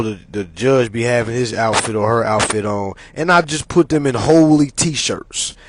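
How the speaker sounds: ordinary talk, nothing marked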